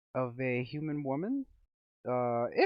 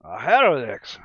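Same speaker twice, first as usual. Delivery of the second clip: ordinary talk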